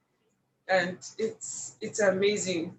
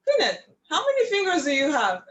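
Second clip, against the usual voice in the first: a lightly voiced mentor, quick and raised; mentor's question; 6 semitones higher, faster than usual. Mentor quizzing young Kenneth, lightly voiced and brisk. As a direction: quiz him like a brisk teacher. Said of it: "and she told me".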